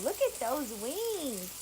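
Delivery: normal talking voice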